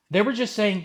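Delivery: normal talking voice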